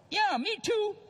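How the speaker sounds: pushing it out high and awkward; squeaky mock reply. Newlywed husband to his wife on the phone, squeaky and awkward.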